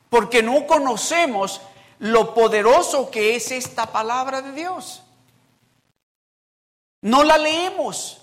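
loud, rising preacher's build on each phrase; fiery preaching. Acted. Pastor rousing his church about neglected scripture, loud preaching. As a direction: hammer the congregation with it.

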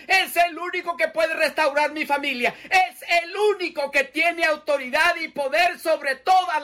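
high, loud preaching cry; preaching at full force. Acted. Preacher to congregation, voicing Peter crying to God, full force.

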